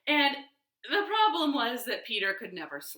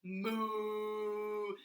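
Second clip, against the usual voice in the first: soft cow moo; 7 dB quieter than usual. Pretend. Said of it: "The cow said".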